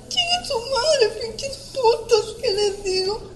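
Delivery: high, choked voice breaking into sobs; weeping as she relives begging for help; real crying. Real, not acted. Attack survivor retelling her plea at the taquería, sobbing.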